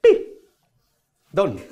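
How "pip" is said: squeaky high pips as the card rises; high, loud cartoon pips; playful high beeping to sell the magic; high pip sound effect.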